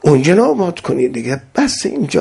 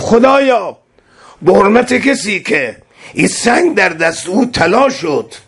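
fervent prayer; 9 semitones higher than usual. Acted. Ammar praying aloud before the Imam, fervent high-pitched plea. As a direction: cry out to God, begging.

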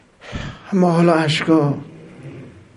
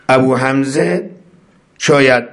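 usual speaking voice

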